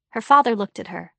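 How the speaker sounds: plain reading voice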